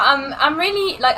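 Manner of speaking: ordinary talk, nothing marked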